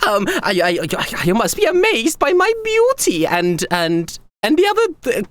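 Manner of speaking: very high, gushing and excited emperor voice; giddy young emperor. Acted. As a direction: squeal each greeting like a flustered young ruler. Same emperor voice.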